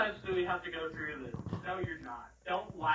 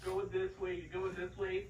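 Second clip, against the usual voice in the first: ordinary talk, nothing marked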